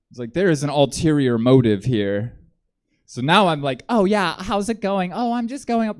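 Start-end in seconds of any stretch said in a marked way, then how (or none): 3.9-6.0 put-on much higher, light girlish voice; passing voice. Hitchhiker chatting with the driver, higher passing voice. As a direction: lift the voice up to pass. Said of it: "I am trying to pass my best".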